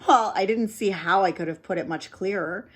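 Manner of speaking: usual speaking voice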